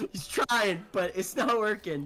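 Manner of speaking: normal talking voice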